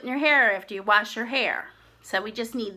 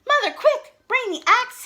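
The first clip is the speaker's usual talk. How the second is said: much higher, louder call; urgent shout; 12 semitones higher, 4 dB louder than usual. Acted. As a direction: shout for mother in a panic. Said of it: "he shouted".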